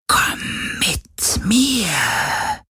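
long drawn-out ghostly whisper; wailing, breath-only beckoning; plaintive luring whisper. A narrator's reading. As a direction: whisper an eerie summons, stretching the last word. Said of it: "klagende Stimme".

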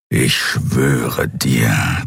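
low, slow beast growl. A narrator's reading. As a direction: growl the threat like a great cat. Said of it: "knurrte der Skria".

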